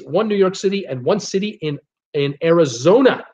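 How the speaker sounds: ordinary delivery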